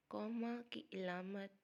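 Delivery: usual speaking voice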